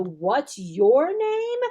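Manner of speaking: slow, stretched model question; slow teacher question. Shown as a demonstration. Teacher asking the puppet, slow and drawn out. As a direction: draw out name. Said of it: "You might have to ask Dino".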